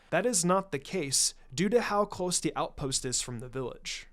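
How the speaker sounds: usual speaking voice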